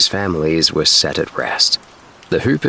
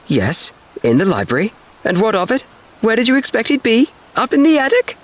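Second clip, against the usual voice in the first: climbing ever higher and louder, "attic" rising; indignant aunt; 3 dB louder than usual. A narrator's reading. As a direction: bristle like an offended old aunt. Aunt scolds the boys.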